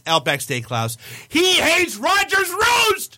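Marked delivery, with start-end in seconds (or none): 1.3-3.2 outraged shout, drawn out high. Real, not acted. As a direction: bellow the name in mock outrage. Listing restaurants he hates.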